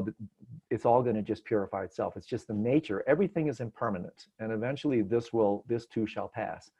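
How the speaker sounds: usual speaking voice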